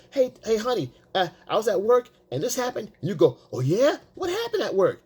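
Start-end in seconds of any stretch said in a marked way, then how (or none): 0.1-2.9 high imitated female voice; mimicked wife. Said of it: "So she comes, she says". 3.5-4.0 higher, over-eager mock reply; matching her energy. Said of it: "I'm gonna match her energy". 4.1-5.0 very high, mock-eager question; matching her energy. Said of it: "I'm gonna match her energy".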